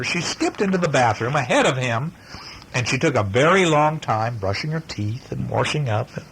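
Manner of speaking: plain reading voice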